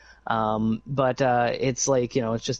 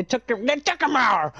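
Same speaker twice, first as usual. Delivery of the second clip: mock outrage; 10 semitones higher than usual.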